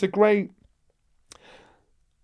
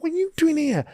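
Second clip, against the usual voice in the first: indignant thoughts; 9 semitones higher than usual. Retold or imitated. Angry inner thoughts, high pitched.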